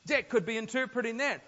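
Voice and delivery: normal talking voice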